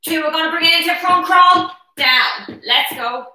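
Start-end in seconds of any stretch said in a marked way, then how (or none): none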